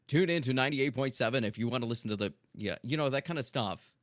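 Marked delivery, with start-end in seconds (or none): 0.1-2.3 fast, punchy radio announcer read; hammed-up DJ plugging the station; radio commercial voice. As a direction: sell it like a local radio ad, quick and upbeat. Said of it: "I repeat the radio commercial to myself".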